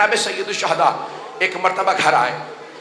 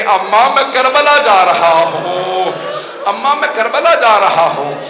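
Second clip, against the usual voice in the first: louder, slow crying call to Amma; loud weeping call; 2 semitones higher, 6 dB louder than usual. Acted. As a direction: wail it out loud, slowly. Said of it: "बुलंद आवाज से रो रो के कहने लगे".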